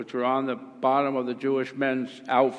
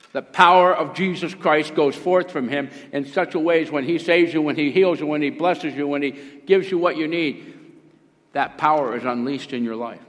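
raised, loud, driving sermon delivery; full-force preaching; 4 semitones higher, 6 dB louder than usual. Acted. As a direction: build a list emphatically for the congregation.

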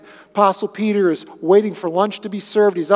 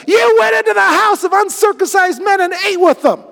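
much higher, louder indignant shout; angry accusation; 10 semitones higher, 6 dB louder than usual. Acted. Circumcised believers shouting at Peter in Jerusalem, outraged. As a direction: shout the charge at him. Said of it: "criticized him and said".

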